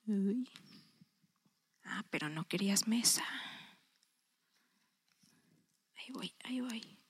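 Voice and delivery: soft unvoiced aside; off-mic whisper. Real, not acted. Aside to a helper while fetching her Bible, whispered. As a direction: whisper to someone nearby, off the talk.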